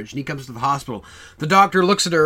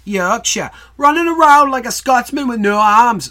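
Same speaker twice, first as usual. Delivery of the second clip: loud, high mock Yorkshire accent; 7 semitones higher, 7 dB louder than usual. Shown as a demonstration. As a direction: ham up a broad Yorkshire accent, loudly. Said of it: "I can do that in a Yorkshire accent".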